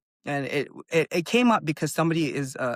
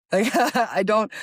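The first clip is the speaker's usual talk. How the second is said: laughing; 6 semitones higher, 4 dB louder, slower than usual. Real, not acted. Laughing about crying to dad.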